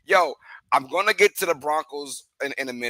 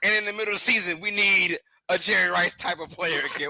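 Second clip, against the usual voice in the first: usual speaking voice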